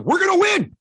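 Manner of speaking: rally him to win; fired-up shout. Retold or imitated. Dad shouting at his son that they will win, fired up.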